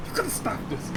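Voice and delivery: high, soft, breathy fake sob; mock crying. Kid pleading with dad, mock crying whimper. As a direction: whimper it like a kid about to cry.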